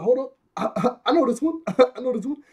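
high, loud, laughing through the words; eager character voice. Retold or imitated. Kobe jumping in to answer Alvida's question, mock eager. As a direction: blurt it like an eager know-it-all.

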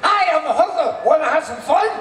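high, loud squawking parrot; shrill bird mimic saying a come-on. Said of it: "they say".

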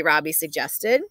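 plain reading voice

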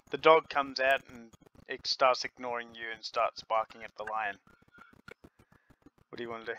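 ordinary talk, nothing marked

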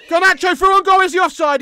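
real live excitement; excited commentary. Real, not acted. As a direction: shout as the striker breaks through. Live match reaction.

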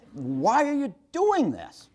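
high and slow, stretching each word; earnest anger. Retold or imitated. Father repeating himself at his frightened son, stern earnest anger. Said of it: "the anger in my voice".

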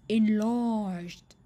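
usual speaking voice

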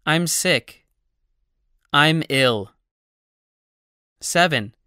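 ordinary talk

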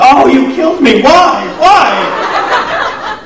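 high, loud comic wail of dismay; theatrical overwrought protest; mock anguish. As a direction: cry out in hammed-up despair. Acting out dismay at casting news.

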